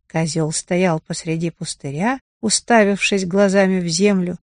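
ordinary delivery, nothing marked

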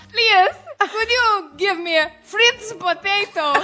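put-on halting, slow Brazilian-accented English; slow, labored English in a heavy Brazilian accent; Borat-like English. Dad struggling to order food in Borat-like Brazilian English. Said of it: "o papai é o Borat no inglês".

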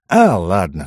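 plain reading voice

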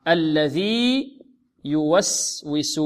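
الذي held long, pause, then يوسوس; very slow syllables, clear ya starting يوسوس; separated ya. Shown as a demonstration. Teacher breaking down the verse, separated ya. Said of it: "لا بد من إظهارها".

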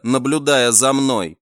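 plain reading voice

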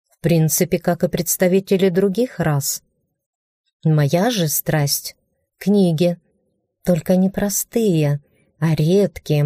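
plain reading voice

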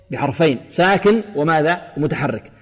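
usual speaking voice

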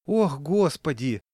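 slow sighing lament. A narrator's reading. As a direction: langsam seufzend. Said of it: "вздохнула".

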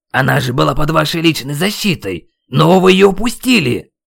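shout, building to the end; yelling. A narrator's reading. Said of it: "продолжал я орать". (гневный крик с нарастанием)

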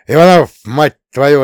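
loud, angry bark at the driver; bellowed reprimand; barked curse. A narrator's reading. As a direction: roar at a subordinate. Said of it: "рявкнул дядя Илья".

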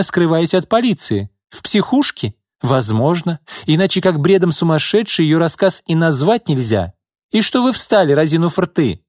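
furious denial, voice soaring; angry. Acted. Izolda angrily denies knowing her sister.

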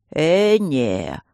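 put-on mumbling old guardian. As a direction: mumble it like a toothless old man. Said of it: "прошамкал первый".

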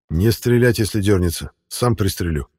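ordinary delivery

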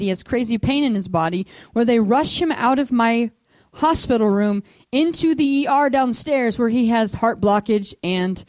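normal talking voice

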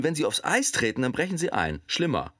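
ordinary talk, nothing marked

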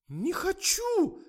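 very high, loud, slow shriek. A narrator's reading. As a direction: squeal it in protest. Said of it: "завизжала Катя". (пронзительный визг)

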